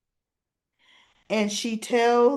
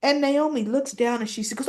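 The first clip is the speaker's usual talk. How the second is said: usual speaking voice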